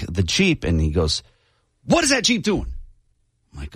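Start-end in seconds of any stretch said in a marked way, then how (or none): 1.8-2.7 imitated grumpy cop, higher pitch; angry cop question. Cop quizzing the driver at the Jeep, mock annoyed and high. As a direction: demand it like an annoyed officer. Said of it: "having a really bad day".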